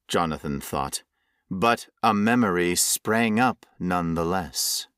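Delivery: ordinary delivery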